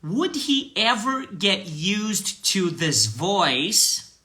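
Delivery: very slow, careful English line; slow repeat-after-me. Shown as a demonstration. Teacher reading slowly for students to repeat. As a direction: read it slowly for students to echo. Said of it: "Vamos lá".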